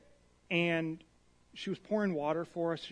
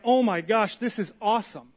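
thrilled; 4 semitones higher, 7 dB louder than usual. Retold or imitated. People thrilled with the gift.

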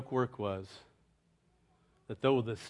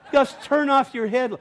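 high laughing call, much higher; calling to Gus; 12 semitones higher, 8 dB louder than usual. Retold or imitated. Crew calling to Gus in the dark, high laughing call. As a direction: tease him across the camp, laughing. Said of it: "We'd go".

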